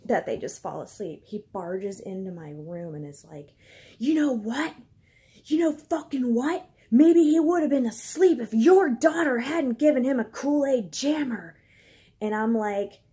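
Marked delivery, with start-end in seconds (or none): 4.0-11.6 higher, louder imitation of a yelling husband; mock angry yell. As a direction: throw his furious outburst back at him. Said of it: "busts into my room yelling like that".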